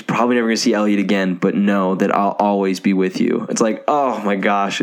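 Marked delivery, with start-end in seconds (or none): none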